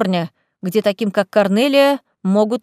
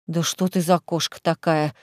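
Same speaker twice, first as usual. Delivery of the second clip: eye-rolling; 5 semitones lower, 4 dB quieter than usual. A narrator's reading. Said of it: "закатила глаза Лиза".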